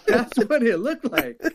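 laughing through the words; can't stop laughing while talking. Real, not acted. Laughing at his own joke.

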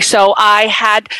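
ordinary delivery

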